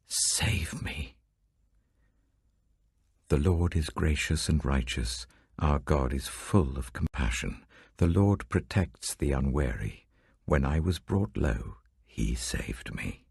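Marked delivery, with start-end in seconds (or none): 0.1-1.2 breathy whispered prayer; whispered plea. Acted. Psalm reader's plea to God. 12.1-13.3 hushed and slow, almost no voice; slow whisper. Acted. Psalm reader recalling God's rescue.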